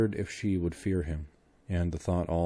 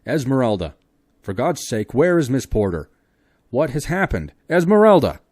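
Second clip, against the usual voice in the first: loud, high, desperate yells; rising panic, loudest at the end; frantic shouting; 7 semitones higher, 12 dB louder than usual. Acted. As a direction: shout to wake a fainted woman. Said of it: "he shrieked in her ear".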